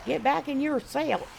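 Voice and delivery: high, slow, botched Southern drawl; bad Southern accent. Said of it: "I can't even do the Southern accent at all".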